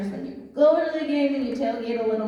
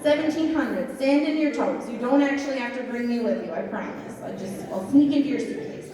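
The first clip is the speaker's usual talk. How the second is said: usual speaking voice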